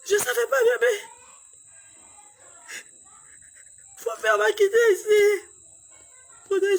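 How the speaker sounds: high sobbing wail, loud and breaking; wailing in tears. Real, not acted. Mother at her sick baby's bedside, wailing in tears. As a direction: cry the words out, grief overflowing.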